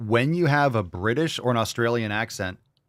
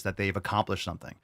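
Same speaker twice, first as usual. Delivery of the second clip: ordinary talk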